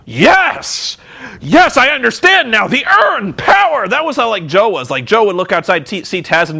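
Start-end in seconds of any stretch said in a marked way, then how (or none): 0.1-3.9 high, hammed-up triumphant cry; mock wrestler suddenly charged with power; Undertaker. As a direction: cry it out in exaggerated revelation. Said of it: "Taker would be like".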